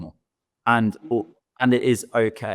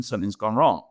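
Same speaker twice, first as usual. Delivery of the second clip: ordinary talk, nothing marked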